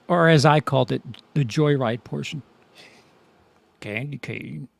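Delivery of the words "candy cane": low, very soft menacing call; hushed, deep movie-villain impression; creepy villain quote. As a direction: murmur it low, like a stalker. Quotes the movie villain's radio line.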